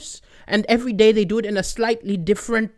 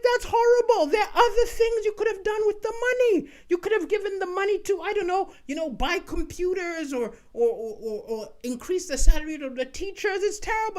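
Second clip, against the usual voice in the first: put-on outraged kid; 10 semitones higher than usual. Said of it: "I was like".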